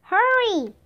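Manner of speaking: high, urgent cry. A narrator's reading. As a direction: cry out to spur the horse on. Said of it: "Jack cried".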